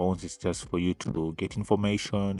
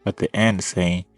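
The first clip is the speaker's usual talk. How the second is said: louder, pressing every point; heated rant; 6 dB louder than usual. Real, not acted. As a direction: push back hard, voice raised. Heated rebuttal to the video.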